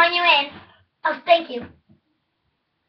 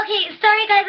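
ordinary delivery

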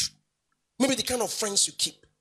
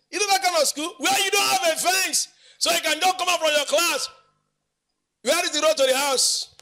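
high, projected storytelling from the pulpit; animated preaching; 8 semitones higher, 4 dB louder than usual. Acted. Preacher recalling a school without fence, animated. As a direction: tell it out in a raised pitch.

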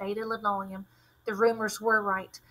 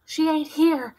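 panting; 6 semitones higher, 6 dB louder than usual. A narrator's reading. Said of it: "Dale panted".

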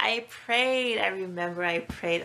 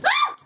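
very high sharp shriek; short scream of fright; startled shriek; 11 dB louder than usual. Real, not acted. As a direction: yelp in panic.

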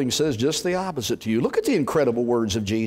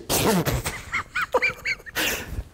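mocking laugh; 3 dB quieter than usual. Crowd laughing at fishermen.

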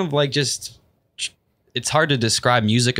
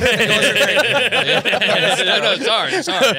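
laughing; 11 semitones higher, 3 dB louder than usual. Real, not acted. Friend laughing after seeing a creepy face. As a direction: talk through giggles.

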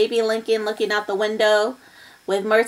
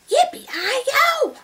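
imitated shout. Baby imitating the shout for sister, high mock yell. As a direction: mimic a far cowboy whoop up high. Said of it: "There is somebody outside shouting".

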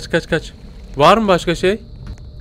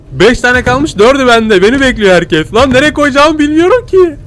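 louder, higher frantic rush; 7 semitones higher, 4 dB louder than usual. Real, not acted. Gamer holding the last items, frantic. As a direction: let the pressure push the voice up. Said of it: "çok gerginim".

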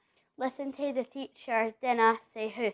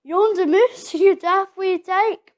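laughing, higher and louder, fond teasing; a father laughs at his son's tale, laughing; 6 semitones higher, 10 dB louder than usual. Acted. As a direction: chuckle it out like a proud farmer father. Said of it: "His father laughs".

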